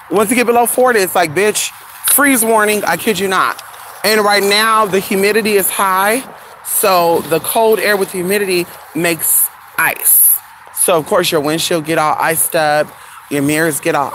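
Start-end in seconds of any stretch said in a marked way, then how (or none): none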